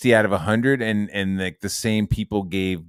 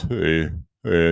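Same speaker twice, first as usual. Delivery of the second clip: imitating a streamer; 4 semitones lower than usual. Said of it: "He talks like this".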